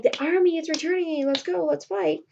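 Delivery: high-pitched mock battle cry; urgent rallying call. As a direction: imitate rousing soldiers to fight. Wounded officer rallies, acted.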